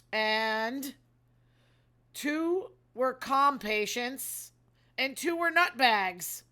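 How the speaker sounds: slow mocking emphasis.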